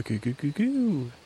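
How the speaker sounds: cooing that leaps up very high; cute Pooh voice. Shown as a demonstration. Showing how the honey-eating bear sounded, cute high coo. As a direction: coo cutely, swooping up at the end. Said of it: "Pooh had more of a cute vo".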